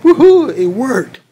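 excited cheer. Acted. Donnie cheering that his machine worked, thrilled. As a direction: celebrate that it worked.

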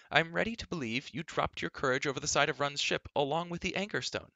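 put-on high, swooping girlish tirade; Elenwy, indignant. A narrator's reading. Girl refusing to stay bored in the castle, indignant. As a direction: protest hotly in a high voice.

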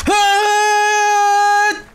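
loud, high scream of fright; startled scream. Real, not acted. Player falling off a ledge, high startled scream. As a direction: scream as the jump goes wrong. Sudden fall jump scare.

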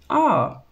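high, satisfied ah; contented sigh. A narrator's reading. Woman sighs after being fed wine, contented. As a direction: sigh with pleasure. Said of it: "she sighed contentedly".

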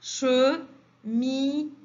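"Cheminée" slowly split, che... mi... née; syllable by syllable. Shown as a demonstration. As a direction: sound out each beat of "Cheminée". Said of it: "la deuxième ou bien la dernière". (« Cheminée » découpé lentement en trois syllabes)

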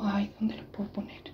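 whispered to herself; hushed aside under her breath. Real, not acted. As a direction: breathe it as a whisper while searching. Whispering to herself while searching.

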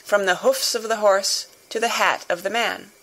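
usual speaking voice

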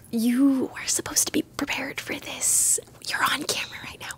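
hissed whisper off to the side. Real, not acted. Host whispering a scolding to a guest on camera.

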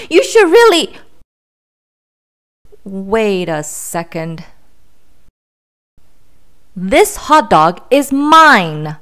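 lightly voiced pigeon, grand then slow and possessive; pigeon showing off. Pigeon boasting to the duckling then claiming the hot dog, slow and possessive. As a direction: savor each word, then snap back to guarding it.

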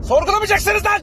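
high yelling burst; outburst. Acted. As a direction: snap at them, pitch jumping up. Bursts out at the end. (yüksek sesle patlayarak bağırma)

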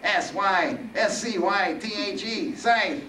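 high, slow, irritated Reaper; exasperated spelling-out. Acted. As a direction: spell it out as if to an idiot. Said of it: "the Reaper lost his cool".

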